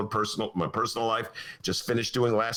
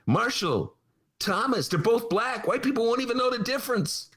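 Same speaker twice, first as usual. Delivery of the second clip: high-pitched mocking adviser voice; oozing cynicism; 12 semitones higher than usual. Advisers selling their boss a cynical pick, mocking imitation. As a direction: pitch it high and gleefully scheming, as mockery. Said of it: "I could just hear, like, the cynicism".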